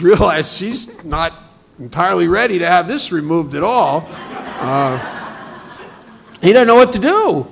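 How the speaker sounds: narrating through real laughter; laughing. Real, not acted. Speaker laughing at the story.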